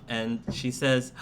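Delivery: usual speaking voice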